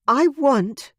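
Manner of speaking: high, loud exasperated huff; huffy. Acted. Veronica huffing at Ian, high and exasperated. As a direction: huff it out, frustrated. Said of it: "she huffed".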